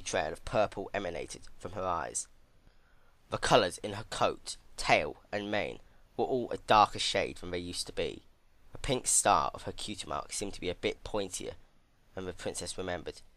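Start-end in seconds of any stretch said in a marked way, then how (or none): none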